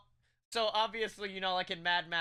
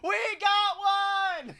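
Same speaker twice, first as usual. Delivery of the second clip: high, slow triumphant yell; excited shout; 14 semitones higher, 7 dB louder, much slower than usual. Retold or imitated. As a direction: cheer it out like a big win. Excited shout when an order arrives.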